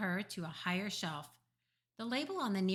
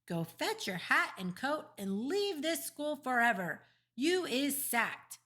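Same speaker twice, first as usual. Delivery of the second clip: raised, indignant head teacher's order; stern sacking; 6 semitones higher than usual. A narrator's reading. Head teacher sacks a teacher in dream, stern. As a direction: dismiss them sternly, higher-pitched.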